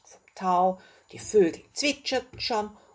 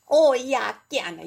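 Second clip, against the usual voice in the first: a bright, louder shout of joy from the little dragon; cheering; 5 semitones higher, 5 dB louder than usual. A narrator's reading. The little dragon accepts Carlotta's invitation, cheering. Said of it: "jubelte der kleine Drache".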